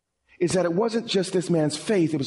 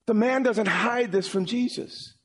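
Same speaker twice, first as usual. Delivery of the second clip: usual speaking voice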